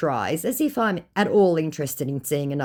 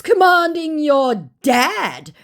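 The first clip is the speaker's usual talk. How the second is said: high, loud, stretched-out imitation; hammed-up outraged quote; mock indignation; 13 semitones higher, 6 dB louder, much slower than usual. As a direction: mimic an affronted scammer, slow and high. Mocking scammer's indignant text.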